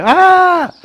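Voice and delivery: a sudden very high, loud yelp of longing; mock cry. Devotee answering a cow's sound with a high cry. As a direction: let out a sharp high cry. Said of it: "you cry".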